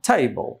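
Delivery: "table" with stress on TA, falling away after it; first-syllable stress. Shown as a demonstration. Teacher shows learners where stress goes, first-syllable stress. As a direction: hit the TA of "table", let "ble" drop. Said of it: "তাহলে প্রথম অংশে stress".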